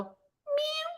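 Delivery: tiny high kitten mew; little meow. Retold or imitated. Woman imitating a kitten she heard, little meow. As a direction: imitate a small kitten's soft cry. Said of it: "I hear this little".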